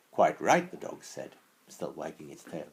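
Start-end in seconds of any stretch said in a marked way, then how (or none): 0.1-0.7 louder, eager dog voice; lightly voiced friendly dog. Dog speaks first.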